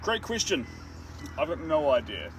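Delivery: usual speaking voice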